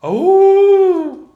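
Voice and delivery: high loud howl on oh; wolf howl. Pretend. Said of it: "I have to enact a wolf".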